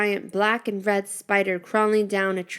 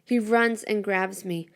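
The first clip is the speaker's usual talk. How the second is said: ordinary delivery, nothing marked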